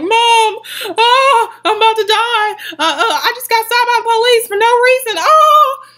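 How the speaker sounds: high, loud frantic plea ending in a scream; panicked crying. Retold or imitated. Calling her mom during the arrest, frantic high cries. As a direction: beg your mom for help in a panic. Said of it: "I was crying on the phone".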